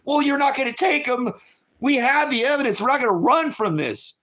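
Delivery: high, insistent pushback; defiant retort. Retold or imitated. Mother retelling how she refused the officers, defiant. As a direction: refuse them flat out, voice up high. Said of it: "And I said".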